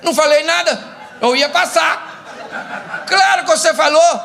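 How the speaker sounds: high-pitched comic protest; protesting wife. Retold or imitated. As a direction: act out an indignant wife snapping back. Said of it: "Aí ela fala o quê?".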